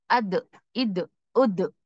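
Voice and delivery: अद, इद, उद said with a sharp stop on the द; makhraj drill. Shown as a demonstration. Teacher showing where the sound stops, sharp द. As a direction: stop each syllable on the द. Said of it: "जैसे मैं बोल रही".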